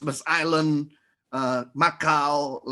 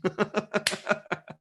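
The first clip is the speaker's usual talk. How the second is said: short, quiet chuckle; a light laugh after the punchline; amused breathy chuckle; soft laugh; 5 semitones higher, 4 dB quieter than usual. Real, not acted. Laughs at own joke.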